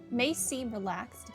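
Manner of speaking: ordinary talk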